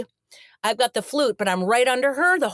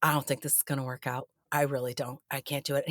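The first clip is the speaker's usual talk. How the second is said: much softer, lower nervous confession; hushed plea; 7 semitones lower, 9 dB quieter than usual. Retold or imitated. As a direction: quietly admit you can't do it. Asking the director to quit.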